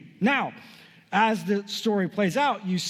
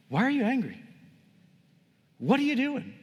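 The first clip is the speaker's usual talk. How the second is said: higher-pitched, incredulous questioning; acted confrontation; 7 semitones higher than usual. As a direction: challenge him, with a pause between questions. God confronting Cain, acted out.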